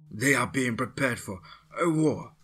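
lightly voiced captive scientist confessing; doctor under guard admits the plan, lightly voiced; nervous scientist. A narrator's reading. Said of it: "said Rousseau".

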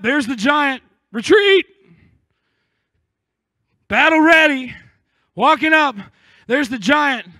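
full-force preacher retelling Saul's army; preaching. Acted. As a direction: preach it loud and building. Preacher building up the scene.

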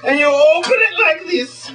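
high, hammed-up mock Swedish showman; Swedish magician. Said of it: "as the Swedish magician".

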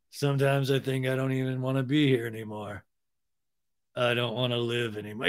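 mock depressed monotone; low-key comic despair. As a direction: imitate a man quietly giving up. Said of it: "he gets really depressed".

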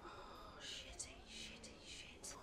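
real whispered curse under breath; whispered swearing. Real, not acted. Giving up on the bit, whispered curse. Muttered swear after failing.

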